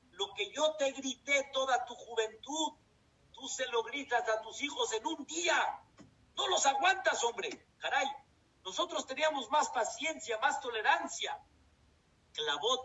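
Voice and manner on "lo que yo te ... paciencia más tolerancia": scolding grandmother. Retold or imitated. Grandmother scolds her daughter.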